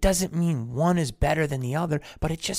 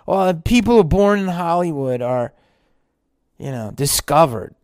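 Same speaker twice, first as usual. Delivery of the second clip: loud, insistent objection; louder doubter; 3 semitones higher, 8 dB louder than usual. Acted. A skeptic insisting that actors just got lucky, loud.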